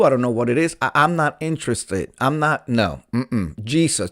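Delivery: ordinary talk, nothing marked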